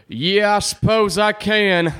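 slow, drawling Southern man; lightly voiced Billy Bob, slow and lazy; Billy Bob impression. A slow drawled Billy Bob line answering his partner. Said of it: "good Billy Bob impression right there?".

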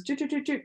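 quick, light snipping noise; scissor snipping sound. Shown as a demonstration. Imitates scissors cutting round the bag.

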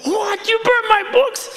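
high outraged mock shriek. Student finding his books burned, high outraged shriek. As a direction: shriek it in disbelief. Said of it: "He's so mad".

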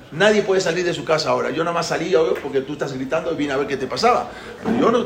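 plain reading voice

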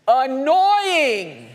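loud, high, drawn-out "annoying"; emphatic exclamation. Shown as a demonstration. Preacher answering what a clanging cymbal is, emphatic exclamation. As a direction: belt it out as the punchline.

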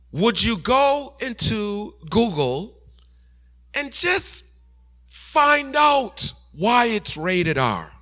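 slow, high and drawn-out asking; slow gentle question. Acted. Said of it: "the Holy Spirit said to me".

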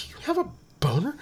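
shocked whisper. Retold or imitated.

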